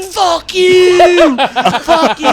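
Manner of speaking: high, loud drawn-out curse in imitation; mock furious yelling. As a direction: yell back like an enraged street character. Homeless man yelling back.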